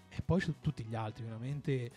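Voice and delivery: normal talking voice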